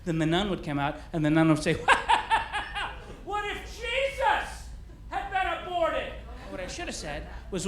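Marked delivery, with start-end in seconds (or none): 1.8-3.2 very high, pulsing wordless quaver; hammed-up warbling noise in falsetto; mock quavering wail. Nun's mock wail before her question. 3.2-6.1 very high, thin-volumed, drawn-out falsetto; softer hammed-up plaintive squeal, stretched out slowly; imitated nun. Nun warns pupils on abortion day, in high mock-plaintive voice.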